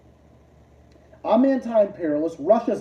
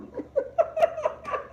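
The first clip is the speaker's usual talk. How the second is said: high unvoiced laugh; 4 dB quieter than usual. Real, not acted. Laughs at own joke.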